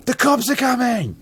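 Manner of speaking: high-pitched, loud panicked cry; alarmed warning. Shown as a demonstration. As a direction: raise the alarm to the gang. Demonstrates alarmed reading of the phrase.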